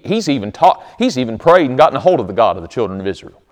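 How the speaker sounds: usual speaking voice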